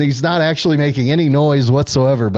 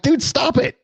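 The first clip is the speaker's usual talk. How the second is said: much higher, whining "stop it"; mock whiny plea. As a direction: plead like an annoyed buddy. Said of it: "I'm like".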